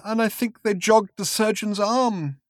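high, slow tearful voice; crying. Acted. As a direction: speak through rising tears. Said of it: "he began to cry again".

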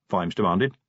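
ordinary delivery

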